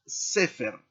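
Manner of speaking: "Sefer" as SE-fer, first syllable strong; stress on first syllable. Shown as a demonstration. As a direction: punch the SE of "Sefer". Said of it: "aquí hay que acentuar la palabra". ("Sefer" con énfasis en la primera sílaba, SE)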